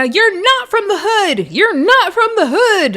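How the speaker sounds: high-pitched mock yell, drawn out; hammed-up, high taunting holler; imitated yelling. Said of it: "literally yelling at Big Lex".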